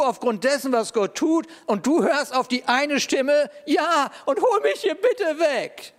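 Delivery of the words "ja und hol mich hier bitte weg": high, slow whine; whiny plea. Pretend. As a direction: whimper it like a sulking child. Mocking Elijah's plea.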